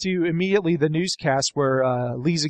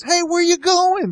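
high, slow calling out; frantic shouting hostage taker; 12 semitones higher, 4 dB louder than usual. Retold or imitated. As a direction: hostage taker yelling after them. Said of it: "and he goes".

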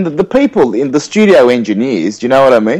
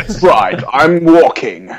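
mock-epic hero voice; put-on grand declamation; Peter Cullen; 3 semitones higher, slower than usual. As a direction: make walking sound momentous. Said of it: "Peter Cullen is too epic".

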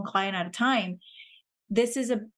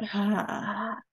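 audible exhale sigh. Shown as a demonstration. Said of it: "exhale with the sound out".

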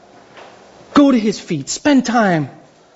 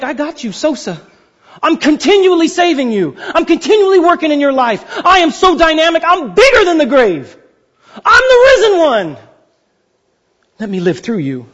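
God reassuring; 5 semitones higher than usual. Retold or imitated. God answers the worried sinner, building to triumph. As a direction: build from assurance to a triumphant proclamation. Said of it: "He's like".

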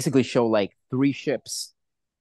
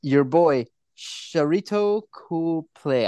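put-on slow, drawn-out South African accent; 4 semitones higher, much slower than usual. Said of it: "That's my South African accent".

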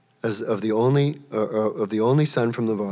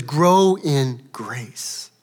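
gentle, slow; 3 semitones higher, 6 dB louder, much slower than usual. Acted. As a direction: offer Grow in grace tenderly, very slowly. He says.

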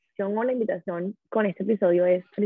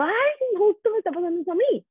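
excited recognition; 12 semitones higher, faster than usual. Retold or imitated. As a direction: voice jumps high on the retold exclamation. Retold relief at shared problem.

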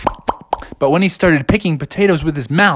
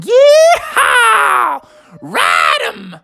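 high, loud cowboy whoop; yeehaw shout; 4 dB louder, much slower than usual. Acted. Bear on horseback whooping loud and high. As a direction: whoop like a rodeo rider.

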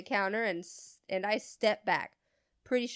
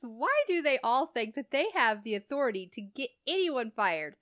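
normal talking voice

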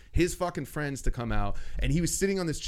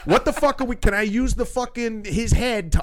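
loud, high, indignant outburst; exasperated rant; 7 semitones higher, 6 dB louder than usual. Retold or imitated. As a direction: rant for permission to throw him out. Acted rant at stubborn customer.

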